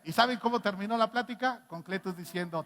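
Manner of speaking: ordinary delivery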